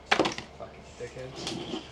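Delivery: whispered, slow curse under the breath; muttered curse. Real, not acted. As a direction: hiss the insult quietly to yourself. Cursing the string maker under breath.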